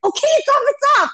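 high-pitched mock caving in; Saul. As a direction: imitate someone hastily backing down. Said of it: "And he's like".

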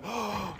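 sharp amazed gasp; mock gasp of wonder. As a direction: gasp like you saw a miracle. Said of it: "with them going".

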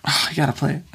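usual speaking voice

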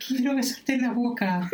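high, amazed blurt; stunned. Retold or imitated. Teacher stunned by Rich's chin pottery, amazed high blurt. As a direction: gasp it out in awe. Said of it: "El profesor Holly, impactado".

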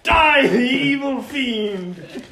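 high, loud, slow battle cry through laughter; stagey fake archaic declaiming. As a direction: declaim like an epic hero. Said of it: "I'm practicing my Beowulf reenactment".

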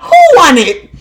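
high, loud fighter's pump-up; big swaggering self-hype, acted out; mirror hype-up. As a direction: shout it like hyping yourself up.

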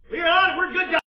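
high, full-voiced acted shout; shouted protest. Accused brothers insisting on their honesty, shouting in protest. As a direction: yell it out in desperate self-defence.

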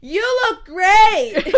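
very high, loud, slow cheer; child's loud yell. Retold or imitated. Little girl yelling a compliment across the room, loud and high. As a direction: shout it across the room like an excited kid. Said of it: "yells really loudly".

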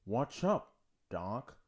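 put-on cartoon Bogart impression; Bugs doing Bogart. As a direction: run "what's up" together, imitating an old film star. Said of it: "he says it like this".

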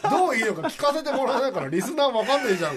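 frustrated listener. Retold or imitated. Co-host chiding hosts for leaving listeners out, mock outrage. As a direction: complain loudly on the listeners' behalf, half laughing. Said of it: "すごいモヤモヤしながら聞いてましたね".

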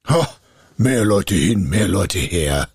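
heavy, scoffing spirit voice; ominous spirit scorn; scornful spirit. A narrator's reading. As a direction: brush it off with menace. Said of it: "rief der Geist".

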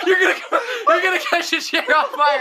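words squeezed out through high, loud laughter; can barely talk for laughing; laughing hard. Real, not acted. Laughing fit over fart fireball.

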